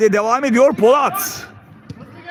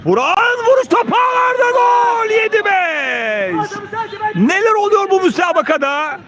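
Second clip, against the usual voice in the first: very high, long-held goal shout, easing into amazement; excited goal cry; 8 semitones higher than usual. Real, not acted. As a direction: stretch the goal and score way out.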